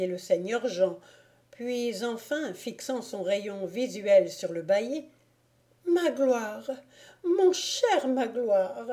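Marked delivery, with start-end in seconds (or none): 5.9-8.9 higher, slow tender lady's voice; reviving wife. A narrator's reading. Wife waking to her husband, tender.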